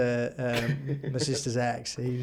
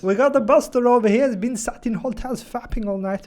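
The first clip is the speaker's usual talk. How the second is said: high, loud mock announcement; hammed-up shout across the room; mock calling out; 8 semitones higher, 6 dB louder than usual. Mock official calling colleagues over.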